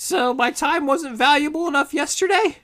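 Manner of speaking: high, loud hag voice; indignant high-pitched hag; affronted hag. Acted. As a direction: demand it like an offended market hag. Offended hag haggles.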